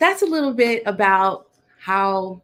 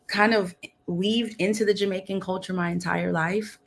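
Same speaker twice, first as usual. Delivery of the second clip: ordinary talk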